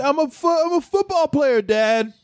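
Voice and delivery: high pleading whine; whiny son voice. Retold or imitated. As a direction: whine like a betrayed kid. Mocking Kosar whining to dad.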